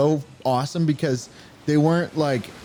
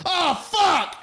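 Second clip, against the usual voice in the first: panicked cartoon cells. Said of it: "Ozzy and Drix are like".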